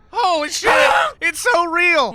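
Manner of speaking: loud, high-pitched exclamation; excited outburst. Pretend. Player reacting to the goose turning real, excited outburst. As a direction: cry out, stunned by the goose. Mock panic over the goose.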